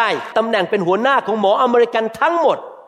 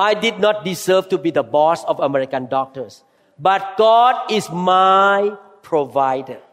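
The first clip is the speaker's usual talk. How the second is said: normal talking voice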